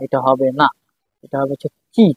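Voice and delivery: normal talking voice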